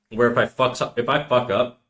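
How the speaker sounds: ordinary talk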